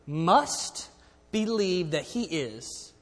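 must set apart and leaned on, then believe that he is drawn out slowly; preacher leaning hard on must; stress on must. Shown as a demonstration. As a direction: hang on the word must before the rest. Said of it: "listen to this word".

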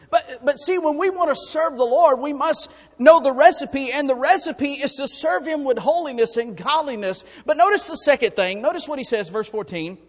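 high, loud impassioned preaching; preaching at full force. Real, not acted. Pastor to congregation, loud high fervent preaching. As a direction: lift the voice and preach hard.

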